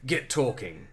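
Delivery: low, soft curt command; barked. Acted. Bond ordering the pilot, low bark. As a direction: snap it like a cold spy. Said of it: "barked Bond".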